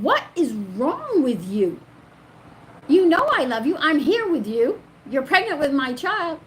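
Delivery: high, loud, emphatic pleading; re-enacted frustrated husband; exasperated reassurance. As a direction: plead with a worried partner to see sense.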